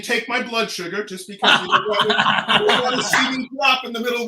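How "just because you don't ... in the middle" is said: talking through laughter; laughing. Real, not acted. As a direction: giggle through the words. Laughing about blood sugar drop.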